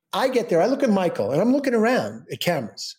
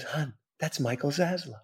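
discreet aside; 9 dB quieter than usual. Retold or imitated. Wife discreetly spots a celebrity.

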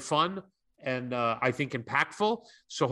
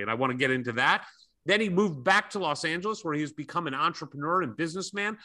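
normal talking voice

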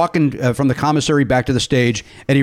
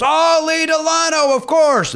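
put-on slow, high, delighted old comic voice; lightly voiced Mel Brooks recognising the name; imitating Mel Brooks; 13 semitones higher, slower than usual. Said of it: "And he goes".

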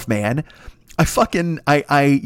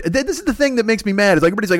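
ordinary talk, nothing marked

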